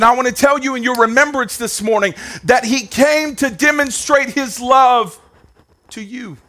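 high, driving sermon voice; fiery Sunday preaching; full-force preaching. Acted. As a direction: preach it hard to the congregation. Preaching at full force, raised pitch.

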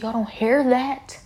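high, loud incredulous outcry; loud, high disbelieving questions at friends who won't listen; retold exasperated shout. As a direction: yell it at the people laughing, pleading. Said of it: "I said".

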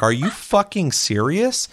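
high and slow, stretched out; imitating Sarah, high and slow; disbelief. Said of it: "She's like".

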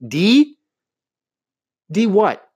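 sharp, high disbelief; can't believe what she's hearing; incredulous. Retold or imitated. Girlfriend baffled by the boyfriend's gift, incredulous.

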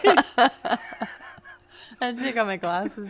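laughing so hard it brings tears; wheezing through laughter. Real, not acted. Laughing at crazy news.

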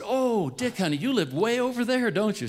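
put-on high, sweet, confused old mother; old mother's voice. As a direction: voice her lightly, fondly puzzled. Elderly mother voiced in story.

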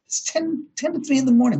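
shocked at the hour, pitch shooting up; startled disbelief. Retold or imitated. Startled by noise at night.

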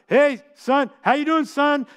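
calling out across the kitchen, imitating dad; imitating dad calling out to his son on the phone, a mild mock yell; yelling from the table. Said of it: "from the kitchen table yell out".

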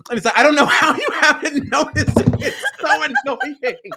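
loud, high-pitched laughing rant; shrieky, real exasperated laughing complaint; laughing exasperation. Real, not acted. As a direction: protest in disbelief through laughter. Laughing exasperation at friend not noticing.

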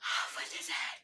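whispered royal order; hushed mock command. Quotes the queen before loud take.

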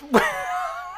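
high, breathy burst of laughter; real laugh. Real, not acted. Host cracking up at his friend's crude comparison, real laugh. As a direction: crack up at the joke.

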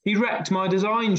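high-pitched storybook shriek; mildly acted indignant shriek; mock shriek. A narrator's reading. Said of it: "shrieked Henry and Peter".

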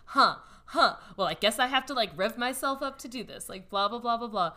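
ordinary talk

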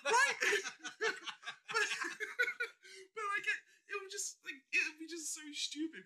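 high, squeaking through laughter; helpless laughter. Real, not acted. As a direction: struggle to talk while cracking up. Cracking up at own idea.

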